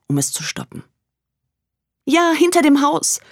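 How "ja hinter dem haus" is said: panicked. Acted. Frightened woman calling police.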